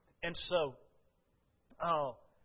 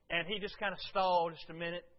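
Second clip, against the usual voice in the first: ordinary talk, nothing marked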